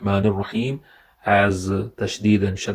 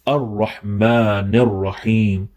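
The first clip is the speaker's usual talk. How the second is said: ordinary talk, nothing marked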